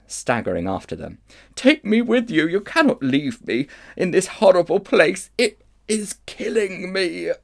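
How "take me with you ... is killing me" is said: pleading witch. Acted. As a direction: plead as if dying.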